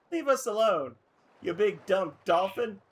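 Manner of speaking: acted angry retort. Character telling the bullying dolphin off, angry. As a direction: tell off a bully, loud and high.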